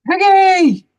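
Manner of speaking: high, loud call of a friend's name. Acted. Elephant calling loudly to his friend Piggie. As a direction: shout for your friend in alarm.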